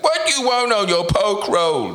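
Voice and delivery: high, mock Vietnamese accent of a shop owner. Mimicking girlfriend's parents, mock Vietnamese accent. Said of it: "that accent of being like".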